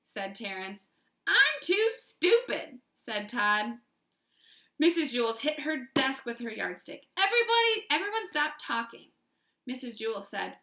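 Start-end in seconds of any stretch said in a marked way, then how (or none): 1.3-2.8 high loud slow whine; whiny excuse. A narrator's reading. Todd whining to the teacher, high and loud. 7.2-9.1 stern teacher. A narrator's reading. Teacher silences class.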